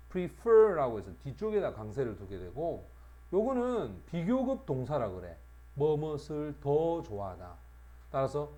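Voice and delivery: ordinary talk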